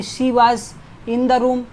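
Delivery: slow contrast example. Shown as a demonstration. Teacher giving a slow contrast example. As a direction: read it slowly to show "in". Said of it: "इस in बताता है".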